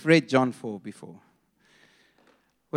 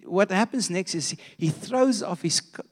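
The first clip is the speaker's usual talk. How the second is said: ordinary talk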